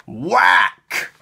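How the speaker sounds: high, loud punchy sound word; loud whack. A narrator's reading. Reader to child, acting the stick's loud whack. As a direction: smack the word like the stick hits. Stick smacks a snowy tree.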